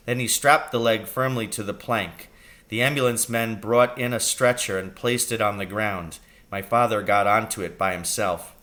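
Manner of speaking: plain reading voice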